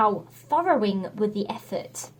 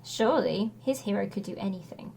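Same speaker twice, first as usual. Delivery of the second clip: plain reading voice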